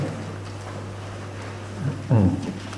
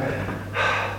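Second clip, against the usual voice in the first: a long, soft, breathy sigh; tired sigh; 11 dB quieter than usual. Pretend. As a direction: sigh like you've finished a hard week's work. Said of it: "stand back and go".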